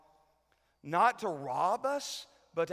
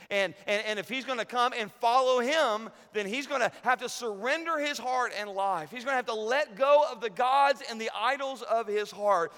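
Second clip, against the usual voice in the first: higher and louder, driving the point home; full-force preaching; 8 semitones higher, 9 dB louder than usual. Acted. Pastor tells the congregation about surrender, full-force preaching. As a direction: preach it hard to the crowd.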